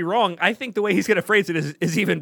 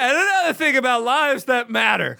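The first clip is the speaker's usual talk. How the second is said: mock drunk speechifying; drunken rambling; 2 semitones higher than usual. Co-host joins in as the drunk chamber head, rambling. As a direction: imitate a drunk launching into another point. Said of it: "rambling drunk".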